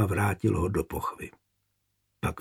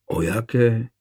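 lightly curious question. A narrator's reading. Brother asks about a girl he knows nothing of, curious. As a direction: ask with a touch of curiosity. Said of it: "zeptal se zvědavě Prokop".

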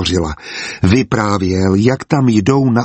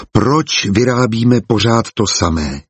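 ordinary delivery

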